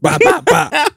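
high imitated popping noise pa pa pa; imitating a knee popping; knee popping sound. Shown as a demonstration. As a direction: mimic the knee pop, high. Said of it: "that's how the knee sounded".

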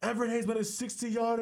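high, giddy news blurted out; thrilled announcement. Retold or imitated. Telling the moviegoers about the kick, high giddy blurt. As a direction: spread the news breathlessly.

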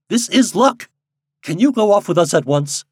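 high, loud, hearty delivery; lightly acted relief; pleased and eager. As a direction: press the guide to set off at once. Delighted employer hires the guide.

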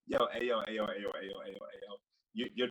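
ordinary talk, nothing marked

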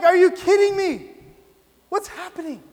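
high, loud, indignant outburst that drops off at the end; retold outrage. Recalling being duped by the taped Olympics broadcast, indignant outburst. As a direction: replay your fury at being duped. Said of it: "and I'm so angry".

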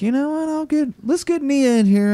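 very high-pitched mock announcement; imitated comedian calling wife. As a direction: pitch it way up, as if summoning someone.

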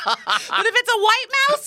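high loud laughing shout. Real, not acted. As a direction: burst out laughing. Laughing outburst.

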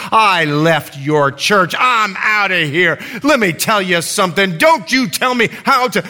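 mock angry rant building up; worked-up mock outburst; retold fury. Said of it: "She was so mad".

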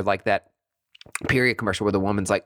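ordinary talk

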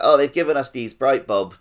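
normal talking voice

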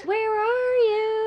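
high, searching call; calling from far away. Pretend. Calling out in the dark.

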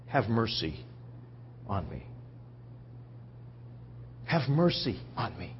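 humble quiet plea. Acted. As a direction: beg for mercy under your breath. Quotes beggar's humble plea for mercy.

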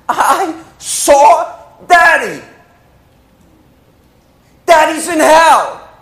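high, slow, loud anguish; anguished cry. Retold or imitated. Sister telling her brother she saw their father in hell. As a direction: cry out about Daddy in hell.

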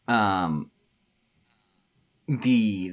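ordinary delivery

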